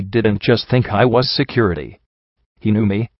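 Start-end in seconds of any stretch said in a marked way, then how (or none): none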